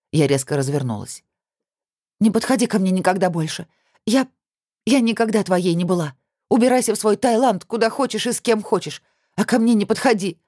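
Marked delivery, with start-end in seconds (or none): 2.2-10.4 agitated high outburst, rising as it goes; voice breaking. A narrator's reading. Woman warning off her ex, voice near breaking. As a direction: build to a high, cracking warning. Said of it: "Голос сорвался".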